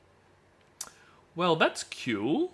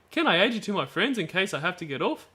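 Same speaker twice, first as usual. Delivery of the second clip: lightly voiced young girl, a touch higher; girl's voice; 6 semitones higher, faster than usual. Rereading the girl's chat line in a light girl voice. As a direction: read it as the girl, gently lifted. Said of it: "that was her saying it".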